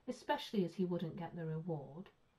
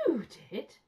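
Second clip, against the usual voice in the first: high, loud disbelief; shocked exclamation; 6 semitones higher, 6 dB louder than usual. A narrator's reading. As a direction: exclaim it as if stunned. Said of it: "exclaimed Mister Brown".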